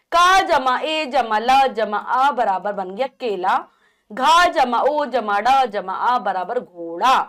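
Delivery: very slow, each part held apart; slow spelling out. Shown as a demonstration. Teacher slowly spelling a syllable for children. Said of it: "उदाहरण है".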